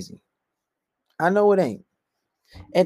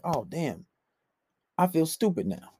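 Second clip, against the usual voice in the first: soft, lowered admission; mock sheepish; 3 semitones lower, 8 dB quieter than usual. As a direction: say it small, as if embarrassed. Acts out embarrassed man's reaction.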